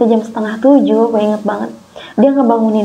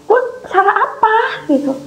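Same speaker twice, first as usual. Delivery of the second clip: higher, near tears; upset; 9 semitones higher than usual. Real, not acted. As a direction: let the hurt push the pitch much higher. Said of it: "gue sedih gitu". (nada sedih yang hampir menangis)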